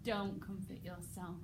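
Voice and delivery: quiet menace. Shown as a demonstration. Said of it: "can you imagine him doing the whole".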